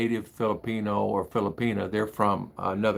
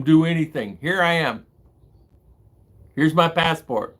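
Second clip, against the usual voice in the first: indignant demand; 6 semitones higher, 5 dB louder than usual. Retold or imitated. Imagined call demanding his check.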